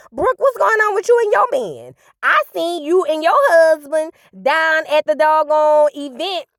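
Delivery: mock teacher voice. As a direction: put on a proper teacher tone. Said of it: "in my teacher voice".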